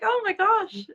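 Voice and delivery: high, amazed gasp of disbelief; mock-overwhelmed oh my gosh; a light, high burst of wonder. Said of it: "you're just like".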